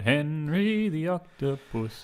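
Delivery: very slow, floaty underwater voice; Henry the Octopus. Acted. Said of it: "floaty voice".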